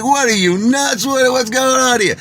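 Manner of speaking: high, indignant acted outburst; freaking out. Man freaking out at the girl, high-pitched outrage. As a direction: go off in a high, incredulous voice. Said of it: "I'm freaking out at this girl".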